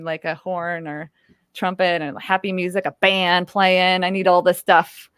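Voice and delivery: ordinary delivery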